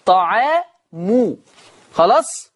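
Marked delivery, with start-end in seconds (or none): 0.1-1.4 طعام slowly recited with the final ميم raised to طعامُ; Hafs reading. Shown as a demonstration. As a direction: stretch out طعام ending in u. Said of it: "ورفع الميم".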